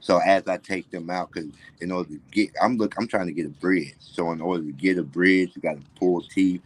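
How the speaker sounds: normal talking voice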